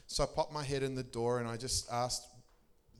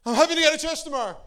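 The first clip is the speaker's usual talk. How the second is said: much higher, much louder, fast hammed-up blurt; mock blurting stranger; 14 semitones higher, 14 dB louder, faster than usual. Stranger at the shoe shop blurting it out, hammed-up outburst. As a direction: let it burst out like an involuntary outburst. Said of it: "Holy Ghost Tourette's just came out of him".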